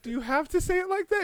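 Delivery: a dictator spooked by her calm; mock unnerved.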